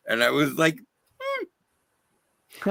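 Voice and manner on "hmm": squeaky, very high reacting hum; high-pitched "hmm". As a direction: let out a tiny high "hmm". Reacting in retold compliment story.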